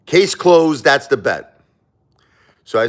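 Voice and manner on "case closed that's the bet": high, loud declaration; pit boss's ruling. Retold or imitated. Pit boss shutting down the player's bet, high loud ruling. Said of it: "He goes like this".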